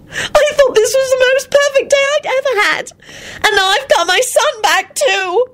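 very high weepy voice; happy tears, squeaky and overwhelmed; sobbing squeak. Acted. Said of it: "trailed off into a high-pitched squeak".

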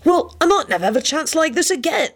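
high-pitched insistent protest. A narrator's reading. Lupin protesting amid countdown.

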